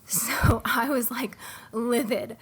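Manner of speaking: breathy laughing whisper, slow; whispered aside. Real, not acted. Telling co-hosts she was livid, in a whisper. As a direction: let it slip out in a whisper.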